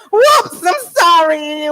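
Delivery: high, loud startled yelp then apology; startled whoops. Real, not acted. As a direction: jump at the mic mishap. Mic mishap, startled apology.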